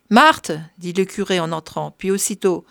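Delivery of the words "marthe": calling out. Acted. Curé calls on entering.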